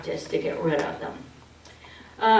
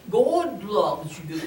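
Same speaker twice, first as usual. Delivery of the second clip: a touch of syrupy piety; mock pious; slower than usual. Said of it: "They're very religious".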